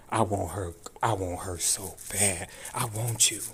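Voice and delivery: normal talking voice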